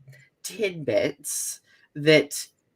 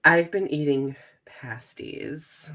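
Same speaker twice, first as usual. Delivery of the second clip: ordinary talk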